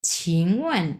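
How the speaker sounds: careful Mandarin demo of ছিংওয়েন; Chinese word read out. Shown as a demonstration. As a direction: say ছিংওয়েন slowly in a falling Mandarin tone. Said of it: "প্রথমে কি আছে?".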